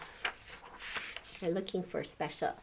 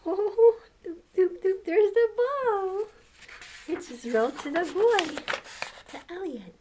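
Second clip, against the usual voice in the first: much higher and louder, gleeful; delighted; 11 semitones higher, 5 dB louder than usual. Real, not acted. Reading to kids, delighted squeal at the rolling ball. Excited reaction while reading.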